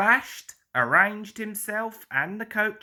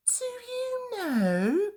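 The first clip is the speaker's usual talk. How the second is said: high, slow little engine voice; Percy the engine; much slower than usual. A narrator's reading. Percy confides in his friends, high and slow. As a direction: pitch it up and stretch it out like small Percy. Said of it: "Percy went on".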